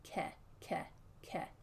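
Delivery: "Ke" whispered on the eh as in bed; whispered vowel drill. Shown as a demonstration. Teacher whispers the sound for the student. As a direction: breathe "Ke" softly to isolate the vowel. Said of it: "Like the eh as in bed".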